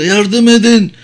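high, strained cry for help; acted distressed calling out; desperate plea. A narrator's reading. Wounded man begs for help.

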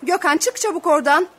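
loud parental command; urgent order. Acted. Mother wants her son out of the narrow hole, ordering urgently. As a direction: call the child out sharply and loudly.

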